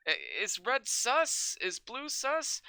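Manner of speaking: slow, drawn-out, deranged questions; slow unhinged meme questions; slow mock paranoia. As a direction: ask each one like it haunts you. Copypasta quoting meme questions.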